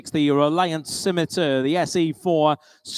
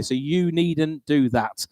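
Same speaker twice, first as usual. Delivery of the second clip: usual speaking voice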